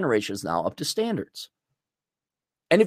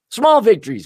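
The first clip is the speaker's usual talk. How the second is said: loud, higher "Small victories" with a falling end; mock-triumphant; 6 semitones higher, 7 dB louder than usual. Real, not acted. To his viewers, a loud mock-triumphant "Small victories". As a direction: punch "Small victories" like a win.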